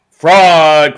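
angry crowd cry. Acted. Maddened crowd yelling at the umpire, loud. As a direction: hurl the word like a furious crowd. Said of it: "cried the maddened thousands".

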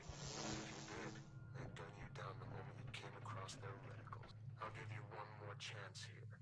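cold whispered threat; menacing AI voice. Acted. Game AI character voice.

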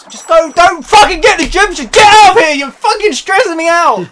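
loud, high, frantic yell; stressed-out yelling. Real, not acted. Snapping at teammates mid-level, stressed-out yelling. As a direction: shout at them in a panic.

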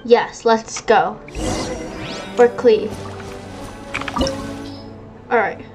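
ordinary delivery

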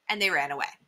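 usual speaking voice

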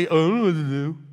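slurred, dopey put-on voice; mock moron. Mocking people who act like morons, dopey. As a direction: mumble it together like a dimwit. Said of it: "you actually act like you are".

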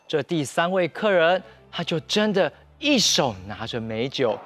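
ordinary delivery, nothing marked

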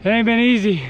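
panting while pedaling uphill; puffing out words on a climb; out of breath. Real, not acted. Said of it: "this hill is killing me".

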